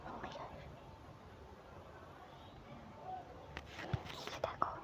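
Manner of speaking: breathless frightened whisper; scared whisper. Real, not acted. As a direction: hush it, barely a breath. Scared whisper during Charlie game. (ভয়ে ফিসফিস করে)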